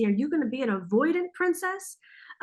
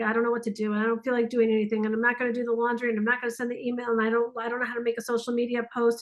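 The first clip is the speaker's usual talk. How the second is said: very fast mock list of excuses; rattled-off excuses; much faster than usual. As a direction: reel off reasons not to act, racing through. Acts out analysis paralysis excuses.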